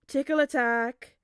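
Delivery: put-on loud, slow playful shout to a child; playful tickle cry. A narrator's reading. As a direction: pounce on a giggling kid. Playful father remembered.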